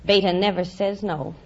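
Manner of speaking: ordinary talk, nothing marked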